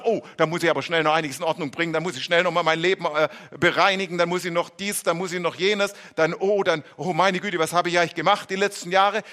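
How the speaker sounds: fast, flustered mock panic; panicky rush. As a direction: rattle it off in a hurry, lightly panicked. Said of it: "in Hektik und Panik kommen und sagen". (hastig, gespielt panisch, schnell heruntergerattert)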